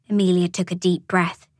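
ordinary delivery

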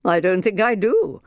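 cold unamused governess; dry. Acted. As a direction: answer flatly and coldly. Minder dry.

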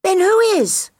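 put-on high, loud childlike voice; young gosling. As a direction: call out like an eager little gosling. Gosling questions his mother.

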